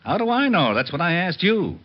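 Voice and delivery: high, exasperated comeback; he's lost the thread and is getting worked up; flustered. Acted. Confused back-and-forth about the lawyer.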